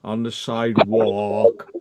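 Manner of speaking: put-on slow, drawn-out American accent; mock American accent. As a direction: drawl it like an American tourist. Mocking American accent, slowed.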